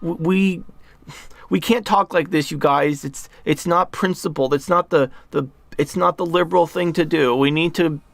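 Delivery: mock them as pleading hand-wringers; whiny naive liberal. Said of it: "a bunch of people are in the room like".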